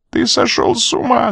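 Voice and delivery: высокий отчаянный выкрик; закричать ему в лицо; крик. A narrator's reading. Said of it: "закричала она". (Рита кричит на Коридона)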